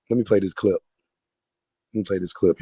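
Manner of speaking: ordinary talk, nothing marked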